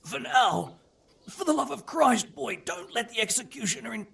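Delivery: high, panicked protest at the sudden threat; alarmed pleading. Acted. Prisoner begging the boy to spare him the executioner, alarmed. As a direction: plead for your life, voice jumping high.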